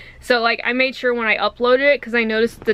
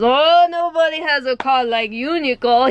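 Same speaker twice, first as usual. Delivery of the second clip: imitated Jamaican accent; 4 semitones higher, 5 dB louder than usual. Manager teasing employee about her car, mock Jamaican accent. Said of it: "He goes".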